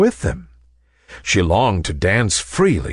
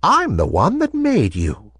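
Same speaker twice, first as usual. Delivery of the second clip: put-on higher-pitched old woman voice; gentle old lady; 5 semitones higher than usual. A narrator's reading. Old lady revealing herself to the ballerina, gentle. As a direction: explain kindly as an old toy maker. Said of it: "the lady said".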